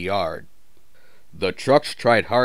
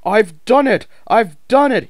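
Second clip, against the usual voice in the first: high, loud victorious cry; triumphant; 13 semitones higher, 9 dB louder than usual. A narrator's reading. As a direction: crow with relief at the top. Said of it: "he puffed triumphantly".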